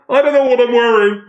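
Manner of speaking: high, loud voice muffled behind glass; muffled through glass. Acted. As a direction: talk as if trapped behind a glass helmet. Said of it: "his voice is, of course, as if coming through glass".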